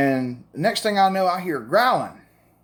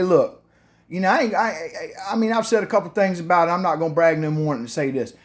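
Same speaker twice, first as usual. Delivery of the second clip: normal talking voice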